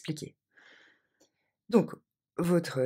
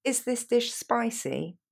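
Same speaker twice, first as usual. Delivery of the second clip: slow model; 6 semitones higher, slower than usual. Shown as a demonstration. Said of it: "est-ce que ce plat est épicé".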